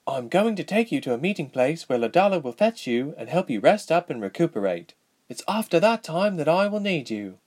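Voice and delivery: lightly acted girlish royal voice; young princess. A narrator's reading. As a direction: lay out the plan as a young royal.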